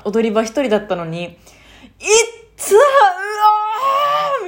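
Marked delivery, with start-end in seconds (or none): 1.2-4.4 high, loud howl of pain, half laughing; pained outcry. Retold or imitated. A woman reenacting howling alone after falling on the stairs. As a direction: cry out いっつー, うわー. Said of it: "みたいになっちゃって".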